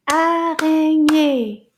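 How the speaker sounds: very slow, loud "Araignée", beat by beat; clapped-out syllables. Shown as a demonstration. Teacher inviting children to clap along, slow and emphatic. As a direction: clap out "Araignée" in three long, loud beats. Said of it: "Can you clap with me?".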